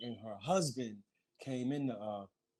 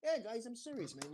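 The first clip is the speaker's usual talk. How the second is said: higher, softer insistent voice; retold reassurance to excited fans, pitched up; imitating Jack Gordon; 9 semitones higher, 6 dB quieter than usual. Host's reassurance acted out.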